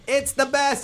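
high-pitched imitation of enthusiasts; mock gushing. Comedian imitating people who push having kids, mock gushing. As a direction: gush it in a put-on high voice.

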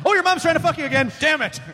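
high, fast excited movie voice; hammy frantic Doc Brown. Acted. Back to the Future joke voice.

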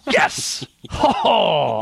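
loud, high-pitched victory whoop; triumphant cheer. Real, not acted. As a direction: cheer like you just won the pick. Wins the pick, celebrates.

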